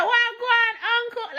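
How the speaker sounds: high-pitched Jamaican patois greeting. Retold or imitated. Greeting the older neighbor, Jamaican patois. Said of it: "I agreed to them in patois".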